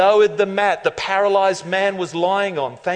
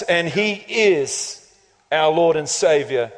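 normal talking voice

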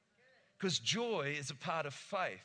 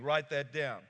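usual speaking voice

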